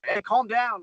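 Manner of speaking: loud retold shout of calm down. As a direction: yell it over a rowdy crowd. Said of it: "but he was like".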